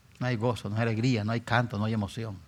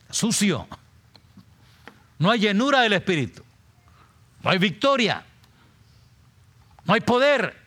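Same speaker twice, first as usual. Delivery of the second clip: loud, high preaching shout; preaching at full force; 11 semitones higher, 6 dB louder than usual. Acted. Preacher shouting to the church about the quenched Spirit, at full force. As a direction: cry it out to the congregation.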